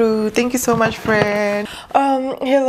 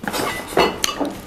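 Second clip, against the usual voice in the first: breathy quiet laugh; 5 dB quieter than usual. Real, not acted. Quiet laugh over gifts.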